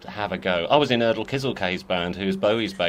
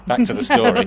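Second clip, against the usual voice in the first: mock announcer boom; 6 dB louder than usual. Mock-stern return to topic.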